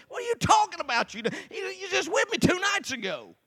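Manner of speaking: high-pitched, incredulous protest; mad. Retold or imitated. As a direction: imitate a friend's indignant outburst. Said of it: "finally he gets mad at me".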